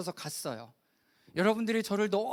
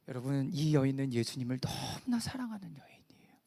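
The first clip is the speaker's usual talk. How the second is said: low, half-whispered aside to the congregation; breath-heavy, lowered preaching voice; hushed emphasis; 8 semitones lower, 4 dB quieter than usual. Real, not acted. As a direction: drop to a near-whisper for the tender point. Hushed first take, then repeated aloud.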